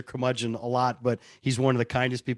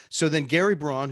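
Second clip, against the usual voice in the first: ordinary talk, nothing marked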